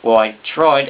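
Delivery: ordinary talk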